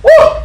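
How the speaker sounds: very high, loud whooping scream; a scream from torment. Retold or imitated. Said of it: "He starts screaming Lazarus' name".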